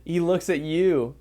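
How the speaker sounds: soothing guided imagery narration; guided visualization. Acted. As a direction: lead them gently through the vision.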